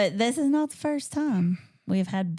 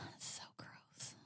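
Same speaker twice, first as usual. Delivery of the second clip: disgusted whisper; 27 dB quieter than usual. Real, not acted. Disgusted at a song.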